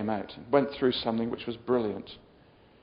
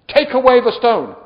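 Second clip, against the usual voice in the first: loud, raised-pitch order; commanding shout; 10 semitones higher, 13 dB louder than usual. Acted. Jesus ordering people at the tomb, commanding shout. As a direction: call out the command with full authority.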